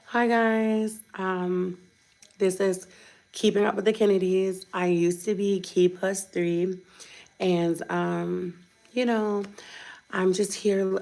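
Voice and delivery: soft, subdued mock vlogger intro; watered-down influencer. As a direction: play a toned-down, hesitant influencer greeting. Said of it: "try to be like".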